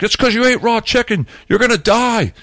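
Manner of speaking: high, urgent mock warning; retold alarm. People warning him about raw chicken, mock alarm.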